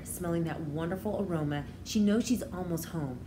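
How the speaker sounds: usual speaking voice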